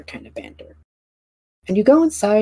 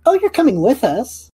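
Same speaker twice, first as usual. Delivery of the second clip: cheery NPC; 10 semitones higher, 5 dB louder than usual. Acted. NPC voiced by GM.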